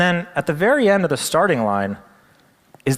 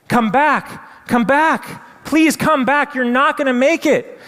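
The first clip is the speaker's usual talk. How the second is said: high, loud pleading chant; crowd pleading in unison; 12 semitones higher, 4 dB louder, slower than usual. Retold or imitated. Whole crowd begging the tiny frog, high and loud. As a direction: beg him to come back, all together. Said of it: "everyone in unison".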